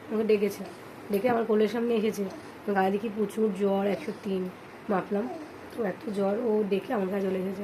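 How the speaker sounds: quiet late-night vlog voice; hushed midnight talk. Real, not acted. Midnight, hushed beside sleeping child.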